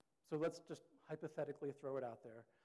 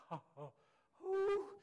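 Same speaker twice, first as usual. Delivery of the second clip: relieved whoop; 9 dB louder, slower than usual. Retold or imitated. Forgiven child releasing relief with a loud happy whoop. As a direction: laugh then a loud high whoop of relief. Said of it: "given grace and mercy".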